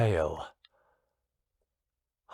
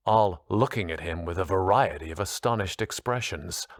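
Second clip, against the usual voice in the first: ordinary delivery, nothing marked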